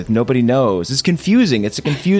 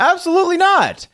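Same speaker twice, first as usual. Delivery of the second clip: high voice. Pretend. High mocking reply.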